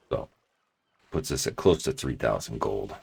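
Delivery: ordinary talk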